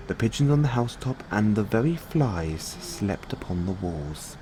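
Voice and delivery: plain reading voice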